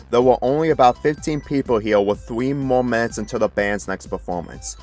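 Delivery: ordinary delivery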